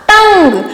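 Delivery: टंग with a distinct ng closing it; full anusvara sound. Shown as a demonstration. Teacher recalling the bindi lesson, टंग with full ng. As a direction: close टंग firmly on ng. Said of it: "क्या पढ़ा था".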